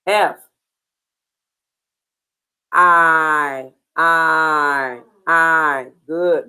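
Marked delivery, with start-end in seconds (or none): 2.7-5.9 "I" drawn out as a long vowel, low; long I sound. Shown as a demonstration. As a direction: hold "I" like the word eye, lower than usual. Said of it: "Long I".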